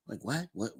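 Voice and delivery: ordinary talk, nothing marked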